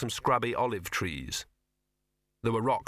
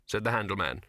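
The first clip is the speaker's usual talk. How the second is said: ordinary delivery, nothing marked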